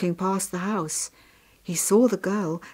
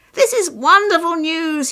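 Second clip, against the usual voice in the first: high, loud jubilant father; overjoyed, booming celebration; very happy; 11 semitones higher, 10 dB louder than usual. A narrator's reading. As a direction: exclaim with great delight. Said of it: "His father was very happy".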